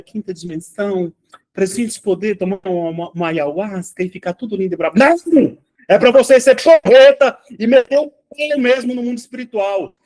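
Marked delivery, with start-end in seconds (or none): 5.0-10.0 high, loud rallying cry; emphatic loud no. Real, not acted. Teacher rousing his students to be brave, loud and high. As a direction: pump the students up with a shout.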